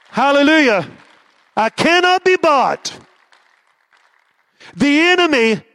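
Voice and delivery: rising, fiery preacher cadence; rally speech. Acted. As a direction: build it like a rally cry.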